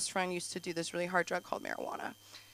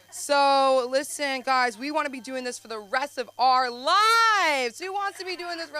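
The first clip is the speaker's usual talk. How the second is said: loud, slow rallying call; host pumping up the audience; hype; 6 semitones higher, 8 dB louder than usual. Real, not acted. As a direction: rev up the crowd. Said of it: "host addressing crowd".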